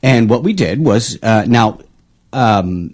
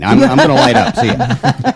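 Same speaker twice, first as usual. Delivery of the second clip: high, laughing, carefree send-off; laughing mock defiance; 14 semitones higher than usual. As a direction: cackle it out like a rebel. Mock reply to the anti-smoking ad.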